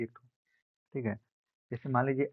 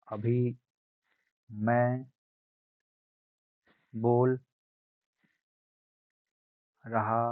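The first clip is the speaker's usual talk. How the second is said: each word louder than the last, slowly spaced; loudness demo; 5 dB louder, much slower than usual. Shown as a demonstration. Teacher demonstrating loudness to students, growing louder word by word. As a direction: build the volume word by word to show loudness. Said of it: "तो इसे ही बार-बार".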